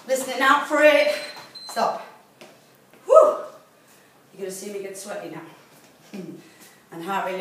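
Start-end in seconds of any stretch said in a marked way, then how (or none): 3.0-3.4 high loud puff of relief after the effort; relieved whew. Real, not acted. Coach blowing out after finishing the interval, relieved whoosh. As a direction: blow it out, spent and relieved.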